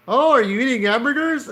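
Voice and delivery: high-pitched hammed-up cop questioning; high mock-interrogation voice; mock officer. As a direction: put on a high, exaggerated officer voice. Officer questioning kids in comic.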